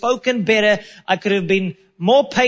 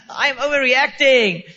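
self-mocking laugh; 4 semitones higher than usual. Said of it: "I'm freaking out".

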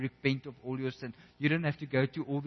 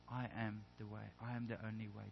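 tender declaration; 3 semitones lower, 12 dB quieter than usual. Acted. Jesus preparing disciples for eternity.

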